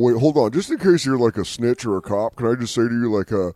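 ordinary talk

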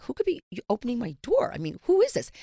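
rising alarm, pitch climbing high; hearing a key in her door, alarmed and high; alarmed surprise. Retold or imitated. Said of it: "I'm like".

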